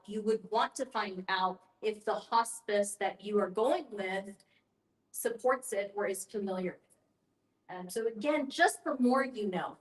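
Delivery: normal talking voice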